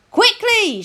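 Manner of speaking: high, loud urging shout. A narrator's reading. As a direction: hurry them along from afar. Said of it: "she shouted".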